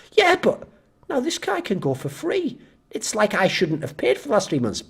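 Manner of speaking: a mock child's whine about paying; whiny gym customer. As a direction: sulk like a child who feels cheated.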